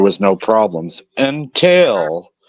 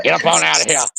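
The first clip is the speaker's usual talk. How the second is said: grandpa voice; 4 semitones higher, faster than usual. Pretend. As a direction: play a cranky old grandpa. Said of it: "hit him with the grandpa voice".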